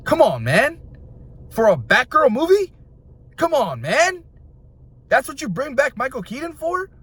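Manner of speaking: high-pitched, rising incredulous questions; exasperated disbelief. Real, not acted. Film fan protesting a wasted actor comeback, incredulous.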